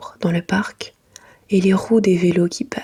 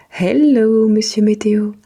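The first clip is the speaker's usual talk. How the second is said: cheerful greeting; 2 semitones higher, 5 dB louder than usual. Acted.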